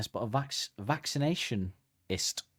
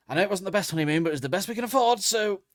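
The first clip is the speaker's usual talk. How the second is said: high mock husband; 9 semitones higher, 4 dB louder than usual.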